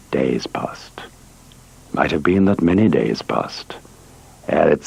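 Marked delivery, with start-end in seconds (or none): 0.1-1.0 softer, whispered and drawn out; hushed whisper. Acted. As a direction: let it fall away into a whisper. Time passing under the pendulum.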